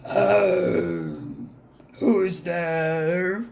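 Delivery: slow, groggy lion just woken; lion waking up in his den; sleepy lion. A narrator's reading. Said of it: "the lion woke up".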